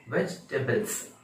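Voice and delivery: वेजिटेबल्स as vech-tebals; correct pronunciation. Shown as a demonstration. Teacher confirming how we will say it, three-syllable demonstration. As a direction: skip the middle i in वेजिटेबल्स. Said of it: "ऐसे बोलेंगे".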